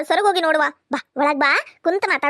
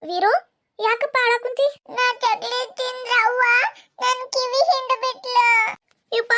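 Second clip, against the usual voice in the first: put-on much higher, slow small boy; child's voice; 8 semitones higher than usual. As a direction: speak as the little brother. (ಚಿಕ್ಕ ಹುಡುಗನ ಧ್ವನಿ)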